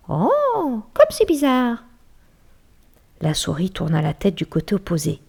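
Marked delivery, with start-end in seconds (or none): 0.1-1.8 high squeaky amazement; mouse's surprise. Acted. Mouse marvelling at the bear's sensitive ears, high. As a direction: marvel in a tiny voice. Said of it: "s'étonna la souris".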